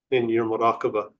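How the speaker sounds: normal talking voice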